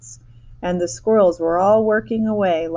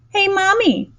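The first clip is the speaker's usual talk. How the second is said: very high, loud bratty call; spoiled girl suddenly shouting for her mother, high and loud; 14 semitones higher, 6 dB louder than usual. A narrator's reading. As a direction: yell for mommy all at once. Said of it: "shouted Veruca Salt suddenly".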